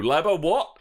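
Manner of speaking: ordinary talk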